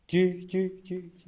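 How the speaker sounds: high loud calls to test the echo. Shown as a demonstration. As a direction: call out to hear it bounce. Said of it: "Kayak menggema ya suara kita mantul".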